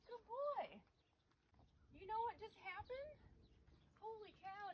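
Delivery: delighted he let you do it; thrilled praise. Real, not acted. Horse accepts saddle pad, thrilled.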